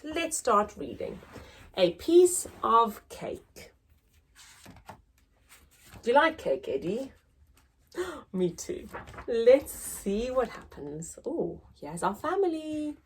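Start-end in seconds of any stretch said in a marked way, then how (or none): none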